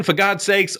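ordinary talk

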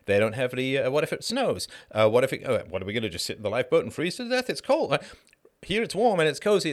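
hammed-up comfortable passenger; mock reluctant friend. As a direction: whine like someone refusing the lifeboat. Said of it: "And then they say".

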